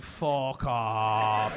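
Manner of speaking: low, drawn-out disbelief; retold disbelief. Comic reacting to pretend laugher, low drawn-out disbelief. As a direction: drag it out low in mock disbelief.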